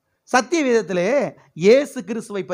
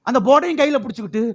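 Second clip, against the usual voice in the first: ordinary talk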